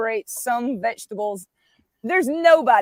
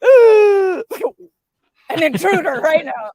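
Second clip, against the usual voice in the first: mock gasp; 6 semitones higher, 10 dB louder, slower than usual. Group's mock alarm at vegan.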